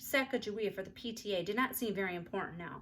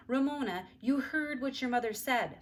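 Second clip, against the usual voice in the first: stern; 5 semitones higher, 3 dB louder than usual. A narrator's reading. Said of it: "Father looked stern".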